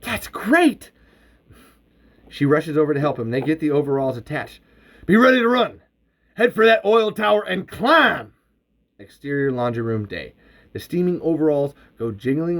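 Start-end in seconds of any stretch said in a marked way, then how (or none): 0.0-0.9 high, excited exclamation; high-pitched burst of admiration; impressed. A narrator's reading. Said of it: "her turn to be impressed". 5.1-8.3 loud, high-pitched order; urgent, raised-voice command; urgent shout. A narrator's reading. Urgent orders during an escape.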